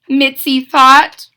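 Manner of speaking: plain reading voice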